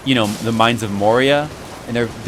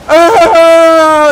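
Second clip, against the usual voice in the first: long, high, loud mock scream; drawn-out melodramatic cry of pain; slow-motion scream; 12 dB louder than usual. Fan mocking the movie stabbing scene, slow-motion scream. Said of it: "in slow motion".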